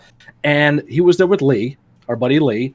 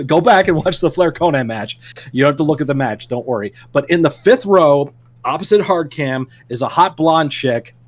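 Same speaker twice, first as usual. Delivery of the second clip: usual speaking voice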